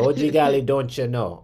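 loud singsong Upper Midwest "don't you know"; loud mock Minnesota lilt; Minnesota Scandinavian accent. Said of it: "that accent like Bobby's mom from Bobby's World".

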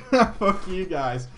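amused, drawn-out jokey swearing; laughing retort. Real, not acted. Streamer cursing his viewers in fun, laughing. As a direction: laugh it off at them.

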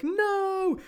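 high loud mock cry of no; Rey screaming no at Luke's death; anguished cry. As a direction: cry out as he dies. Rey sees Luke die.

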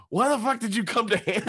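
very high, outraged question; incredulous challenge. Retold or imitated. Woman challenging the comedian's excuse, incredulous. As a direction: throw it back in mock outrage.

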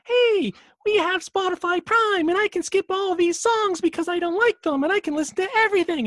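high, loud, mocking chirpy commercial voice; perky ad voice. Listener mimics an ad voice actress who annoys him, mock perky. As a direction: gush in a squeaky, over-perky mock sales pitch. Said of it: "some perky bint on there being like".

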